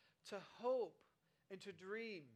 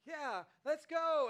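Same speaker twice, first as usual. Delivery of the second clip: put-on much higher, much louder, slow childish cheer; gung-ho toddler; 7 semitones higher, 11 dB louder, much slower than usual. Toddler cheering to go right now, childish. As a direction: shout it like a toddler ready to leave. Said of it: "the toddler's like".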